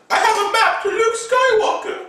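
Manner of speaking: very high eager announcement; high-pitched story voice; high voiced Rey. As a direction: squeak out the big reveal.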